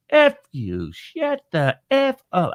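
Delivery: slow, squawky parrot voice; put-on voice of a swearing bird; foul-mouthed parrot. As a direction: squawk it out slowly like a cussing parrot. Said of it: "he's got a foul mouth".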